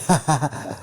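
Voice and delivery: short put-on villain cackle; acted taunting laughter; Joker laugh. A narrator's reading. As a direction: cackle mockingly in a burst. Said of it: "where the Joker is laughing".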